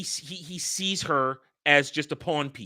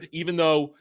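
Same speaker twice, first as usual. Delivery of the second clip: usual speaking voice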